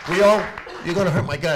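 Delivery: loud, high, anguished protest; anguished outburst. Real, not acted. Father protesting to the mayor over his son's school move, loud anguished outburst.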